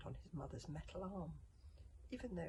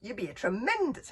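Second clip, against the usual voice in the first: loud, raised bellow; calling out; 6 semitones higher, 14 dB louder than usual. A narrator's reading. Gobber praising his former pupil, loud call. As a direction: boom it out as high praise. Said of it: "called Gobber".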